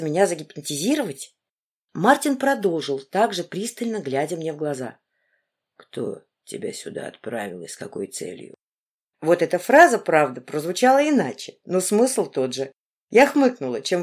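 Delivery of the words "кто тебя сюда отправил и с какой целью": low, quiet menacing man; a grim interrogator, lowered; stern man's voice. Acted.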